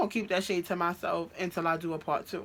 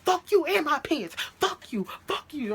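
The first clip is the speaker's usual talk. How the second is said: high-pitched, furious retold cursing; going crazy; 9 semitones higher, 3 dB louder than usual. Girlfriend snapping back about her pants, going crazy. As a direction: lash out wildly, pitch way up. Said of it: "I was going crazy".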